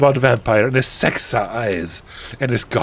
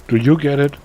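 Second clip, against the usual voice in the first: plain reading voice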